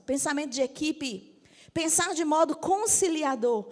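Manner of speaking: normal talking voice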